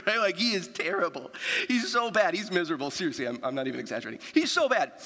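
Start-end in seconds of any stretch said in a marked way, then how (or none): 4.3-4.9 teasing exclamation. Real, not acted. Teasing about friend Justin.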